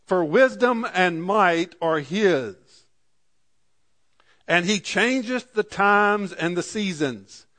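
put-on slow, solemn reading of the old verse; scripture reading. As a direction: read it out like holy writ, unhurried. Reads scripture slowly.

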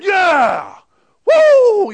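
high, shouted whoop of triumph; celebrating ballplayer. Retold or imitated. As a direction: imitate a player roaring after a home run. Imitates celebrating home run hitter.

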